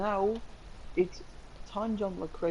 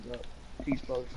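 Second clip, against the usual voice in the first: normal talking voice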